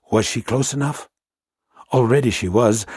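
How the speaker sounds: usual speaking voice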